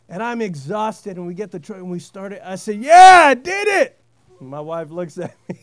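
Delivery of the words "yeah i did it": loud, higher victory yell; triumphant shout. Retold or imitated. Exhausted husband cheering when the truck starts, loud triumphant shout. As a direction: shout it like you just won.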